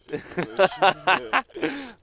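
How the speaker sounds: a high, loud burst of laughter. Real, not acted. Laughing at friend's joke.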